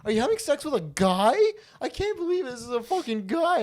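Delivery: high, loud, rising disbelief; so shocked. Retold or imitated. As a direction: imitate her shrieked, appalled questions. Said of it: "she was so shocked".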